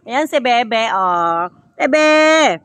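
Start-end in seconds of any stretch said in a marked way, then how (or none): 1.8-2.6 loud, high call with drawn-out endings. Real, not acted. Vlogger greeting Bebe from afar, calling out.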